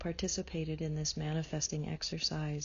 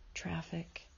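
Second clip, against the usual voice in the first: much softer unvoiced whisper; 7 dB quieter than usual. Guide listing sounds of the imagined day, whispered. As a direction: let the word fade to a whisper.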